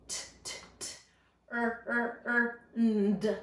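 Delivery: segmenting turned sound by sound. Shown as a demonstration. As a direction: stretch out each sound of turned, T, t, er, nd. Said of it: "Next word is turned".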